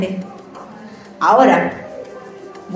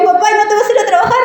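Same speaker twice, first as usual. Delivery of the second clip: put-on child's question; 9 semitones higher, much faster than usual. Child asks dad, high voice.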